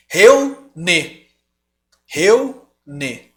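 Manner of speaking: Reúne with eu merged, REU-ne; wrong diphthong. Shown as a demonstration. Teacher showing the unwanted diphthong. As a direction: say Reúne as two syllables, eu glued. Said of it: "Se eu não ponho esse ... fica como".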